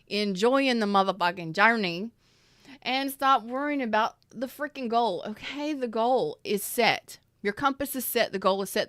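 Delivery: rising to a passionate outburst; emphatic loud. Real, not acted. Emphatic pep talk outburst.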